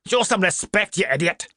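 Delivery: high stern scolding; angry rebuke. Acted. Mister Daly scolding Calcraft after slapping him, angrily. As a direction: put the fool in his place.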